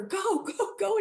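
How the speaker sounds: high-pitched mock yell at normal volume; retold yelling. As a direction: urge the driver on in a high, imitated panic. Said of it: "they're yelling at the, the driver".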